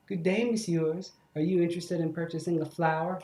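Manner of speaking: ordinary talk, nothing marked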